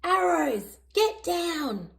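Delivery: high, urgent mock warning cry; a kid playing soldiers calling to her friends to duck; yelling. A narrator's reading. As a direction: call the pretend danger out like a game. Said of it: "She yells to Snickers and Winton".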